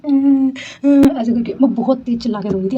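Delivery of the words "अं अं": imitating crying. Vlogger recreating how she cried after falling, mock wail. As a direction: imitate her own childlike wailing. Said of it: "मैं ऐसे रो रही थी".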